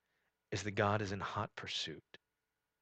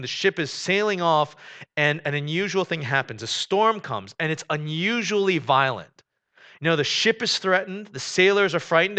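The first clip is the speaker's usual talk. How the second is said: preaching at full force; 6 semitones higher, 10 dB louder than usual. Acted. Pastor to congregation about Jonah, preaching at full force. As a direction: drive each "not" home to the congregation. Retelling the storm passage.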